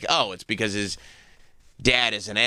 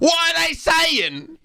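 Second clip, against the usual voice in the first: much higher, slow, exaggerated put-on voice; much slower than usual. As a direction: ape his affected voice, dragging every word. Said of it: "I don't think he talks like that".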